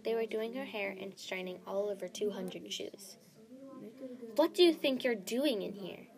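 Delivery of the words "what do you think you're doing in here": loud, indignant storybook yell; angry yell. A narrator's reading. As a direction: yell it as an outraged demand. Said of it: "Snow yelled".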